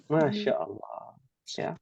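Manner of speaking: soft breathy marvel under the breath; whispered awe. Real, not acted. Teacher marvelling at her students, whispered. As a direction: whisper it in amazement.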